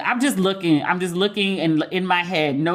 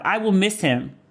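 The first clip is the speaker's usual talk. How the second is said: ordinary talk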